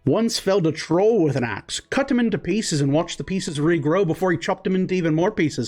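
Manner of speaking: dwarf bragging about his uncle's troll; gruff dwarf. Acted. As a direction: needle him with a boast.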